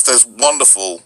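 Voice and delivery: normal talking voice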